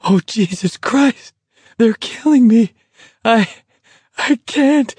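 high, strained, broken phrases; gasping. A narrator's reading. Garrity tells McVrees he can't go on, gasping with laughter. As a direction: gasp it out, helpless from laughing too hard. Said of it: "Garrity gasped".

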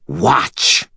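lightly voiced cruel hiss. A narrator's reading. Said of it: "Tessa hissed".

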